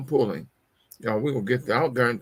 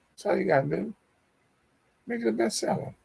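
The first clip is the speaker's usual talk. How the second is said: high-pitched repeat; 9 semitones higher, 3 dB quieter, faster than usual. Shown as a demonstration.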